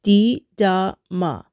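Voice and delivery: slow "Didama", a gap after each syllable; spaced-out pronunciation demo. Shown as a demonstration. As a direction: spell out di, da, ma slowly. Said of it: "next part of the word is pronounced".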